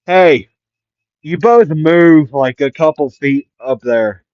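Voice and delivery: loud, drawn-out call from above; yelling down. Acted. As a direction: holler an order to those below. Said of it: "yell out down there".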